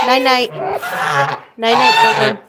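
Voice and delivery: high, loud cooing goodnight to a pet bird. Real, not acted.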